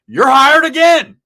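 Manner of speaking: high, booming, drawn-out exclamation; excited shout. Acted. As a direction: shout it out as the thrilled boss. Boss reacting in told story.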